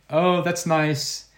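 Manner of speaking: high, falsely agreeable, ending in a loud bye; humouring brush-off. Retold or imitated. Performer humours a crazy visitor out of the dressing room, mock-agreeable. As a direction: humour them and send them off. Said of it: "like this".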